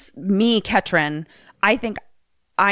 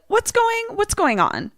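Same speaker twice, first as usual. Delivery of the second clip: high-pitched, disarming question. Pretend. Player trying to gain the upper hand, disarming. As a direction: act innocent to defuse things. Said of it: "almost to disarm her".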